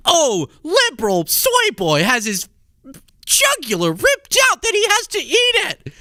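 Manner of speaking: high sneering mock announcer voice; mocking video titles. Mocking his clickbait video titles in a high hammed-up voice. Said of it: "Your whole channel is a drama".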